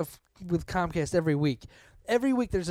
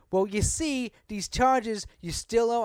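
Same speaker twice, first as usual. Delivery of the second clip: mimicking an unhelpful agent, higher pitch; lightly mocking customer-service attitude, pitched up; 6 semitones higher, slower than usual. Retelling the phone agent explaining charges, mock attitude. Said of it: "got all this fucking attitude".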